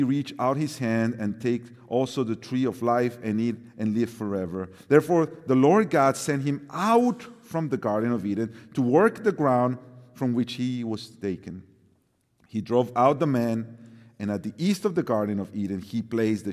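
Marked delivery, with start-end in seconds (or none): none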